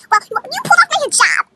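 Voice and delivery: high, loud indignant blurt; mock accusation. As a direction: imitate a girl accusing in outrage. Girl's acted accusation.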